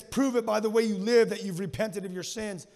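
a stern command from the prophet; a strong call to repent; loud rebuke. Retold or imitated. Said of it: "he drops it on them like this".

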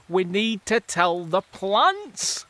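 slow, urgent fungus call; crying out. Acted. Mycorrhizal fungi crying out to warn about the plants. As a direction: call it out slowly. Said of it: "they cry".

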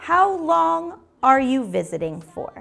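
said slowly for practice. Shown as a demonstration. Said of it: "Voy a decirlo bien lento en inglés".

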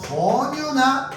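teacher dwelling on 권유나, slow and lifted; slow emphatic. Shown as a demonstration. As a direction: draw out 권유나 to drive it home. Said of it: "부탁의 의문문이야".

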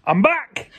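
loud bright I'm back; excited. Real, not acted. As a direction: announce the return loudly. Returning cheerfully loud.

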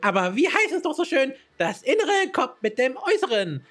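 a chirpy fashion-proud messenger introducing herself; high, bouncy show-off voice; bubbly Astrid voice. Acted. Reads Astrid's lines in character.

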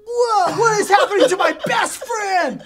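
high, loud, slow melodramatic shock; hammed-up surprise. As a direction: wail it like an overacted audition. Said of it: "do it again with more surprise".

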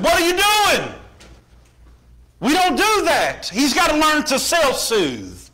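high, loud mock scolding; scolding mama. Mama catching papa holding the crying baby, scolding.

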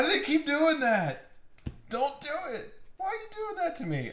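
high, fast squeaky pleading; mock whiny plea. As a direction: beg the cards in a squeaky voice. Pleading with the cards.

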